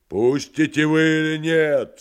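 high, loud threatening yell; menacing shout. Acted. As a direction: roar at the orderlies. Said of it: "страшным голосом крикнул поэт".

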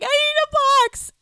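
mock sobbing. Mom pleading at the vet, mock wail. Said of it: "sobbing speech".

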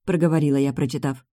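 ordinary delivery, nothing marked

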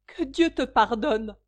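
loud, slow anguished cry; cried out. A narrator's reading. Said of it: "s'écria madame Charpentier".

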